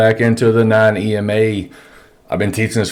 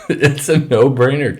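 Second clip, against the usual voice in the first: laughing disbelief; 6 semitones higher than usual. Real, not acted.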